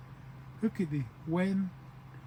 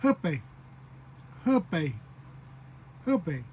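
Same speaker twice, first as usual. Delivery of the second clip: real fast; much slower than usual. Shown as a demonstration. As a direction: punch out "Hupe" as one quick word. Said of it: "real fast like that".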